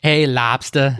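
lobster as lobstah, dropping the r; New England accent. Shown as a demonstration. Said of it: "You're breaking out my fake New England accent".

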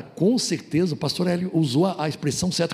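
ordinary delivery

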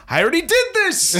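put-on high, loud, laughing kid whine; whiny kid. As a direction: mimic a protesting child. Son's whiny retort, quoted.

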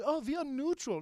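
high-pitched mocking claim; hammed-up, high innocence; mock innocent Swiss. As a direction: protest neutrality in a high, put-on voice. Mocking Switzerland's claim of neutrality.

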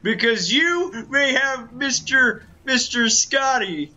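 loud, brash wrestler promo voice; boastful wrestling villain, shouting; Ric Flair impression. Nature Boy voice continues.

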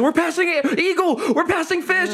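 high, breathless-style race calling; mock frantic progress shouts; excited announcement. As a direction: imitate thrilled teammates crowing. Teammates announcing overtaking, acted.

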